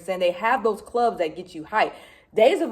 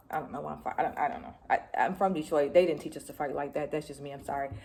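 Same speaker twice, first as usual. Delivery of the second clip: sheepish aside; 6 semitones lower, 8 dB quieter, faster than usual. Real, not acted. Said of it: "Excuse my".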